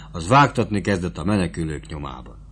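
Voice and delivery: usual speaking voice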